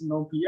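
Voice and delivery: NumPy with the ending said "pee". Retold or imitated. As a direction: say NumPy to rhyme with "bumpy". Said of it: "a Nigerian friend who says".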